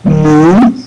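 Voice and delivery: "Mu" said alone as a single slow syllable; sounding out words. Shown as a demonstration. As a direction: sound out "Mu" by itself, the U held. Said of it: "break it into syllables".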